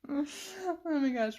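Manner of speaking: normal talking voice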